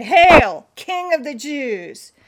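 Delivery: loud, jeering salute; mocking hail. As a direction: hail him with mock reverence. Soldiers mocking Jesus in reading.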